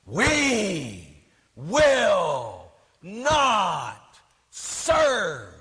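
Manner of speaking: hugely drawn-out chanted cry, word by word; mock screaming. Priest imitating a nun rallying from the podium, mock screaming. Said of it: "she was screaming and yelling".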